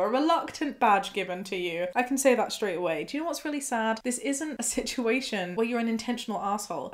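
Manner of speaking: usual speaking voice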